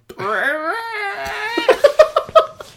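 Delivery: high squeaky rewind noise; tape rewinding. Pretend. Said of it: "[name], make that rewind noise".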